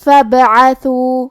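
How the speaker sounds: slow recitation of فبعثو, the ba bounced with qalqala. Shown as a demonstration. Teacher reciting فبعثو for students, ba echoed with qalqala. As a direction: recite فبعثو very slowly, letting the sakin ba echo. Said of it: "ہم با کو ہلاتے ہوئے".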